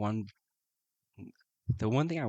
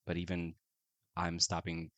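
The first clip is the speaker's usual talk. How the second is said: ordinary delivery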